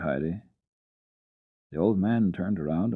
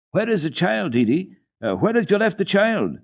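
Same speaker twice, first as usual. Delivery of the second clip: calling out; 11 semitones higher, 5 dB louder than usual. A narrator's reading. Villagers calling from doors.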